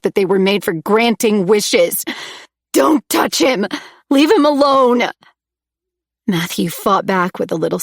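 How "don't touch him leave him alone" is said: high loud pleading yell; desperate shouting. A narrator's reading. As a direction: scream at him to stop. Heroine screams at captor.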